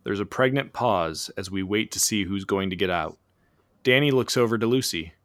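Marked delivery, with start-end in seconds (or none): none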